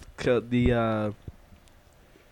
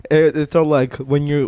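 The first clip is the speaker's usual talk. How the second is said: ordinary delivery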